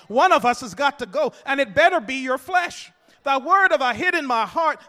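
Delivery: rising preacher's cadence, loud; fiery sermon refrain; preaching. Acted. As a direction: preach it with building force.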